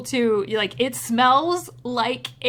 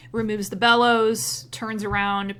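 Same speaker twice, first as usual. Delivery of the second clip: ordinary delivery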